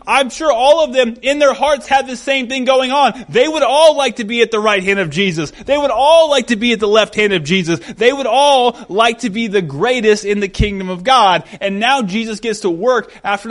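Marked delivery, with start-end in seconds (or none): none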